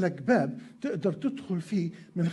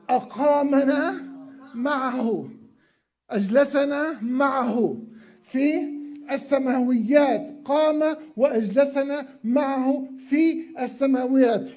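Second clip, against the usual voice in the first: slow proclaiming; 4 semitones higher, 3 dB louder, slower than usual. Real, not acted. Preacher proclaims the resurrection.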